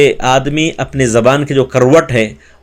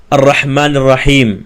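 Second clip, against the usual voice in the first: careful model recitation; slow recitation; slower than usual. Shown as a demonstration. Teacher models the next verse, slow recitation. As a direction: recite الرحمن الرحیم slowly. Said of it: "اور پھر اسی طرح".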